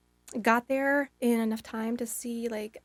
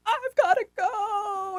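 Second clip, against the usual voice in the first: urgent plea; 11 semitones higher, 6 dB louder, much slower than usual. Retold or imitated. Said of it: "you're like".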